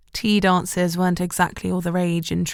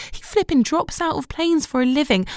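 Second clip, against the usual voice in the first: much higher, incredulous wail; exasperated gush; 8 semitones higher than usual. A narrator's reading. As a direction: she can't believe he's real. Shelley despairing over him.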